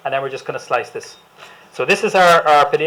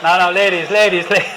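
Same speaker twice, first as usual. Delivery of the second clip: laughing; 4 semitones higher, 7 dB louder than usual. Real, not acted. Calms excited audience, laughing.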